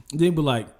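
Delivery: usual speaking voice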